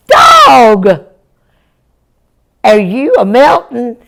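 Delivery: high, loud startled cry; alarmed shout. Acted. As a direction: yell in sudden dismay at the dog. Jack alarmed at wet puppy.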